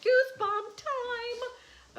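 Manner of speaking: high, loud, slow and thrilled; drawn out with a shiver of awe; goosebump excitement. Real, not acted. Artist teasing her viewers, high and thrilled. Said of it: "okay, wait till you see this".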